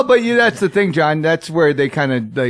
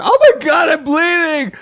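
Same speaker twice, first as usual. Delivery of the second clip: mock panic; 11 semitones higher, slower than usual. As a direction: wail like a wounded fairgoer. Acted Ren fair victim.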